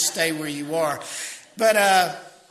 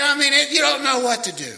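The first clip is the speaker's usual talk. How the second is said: normal talking voice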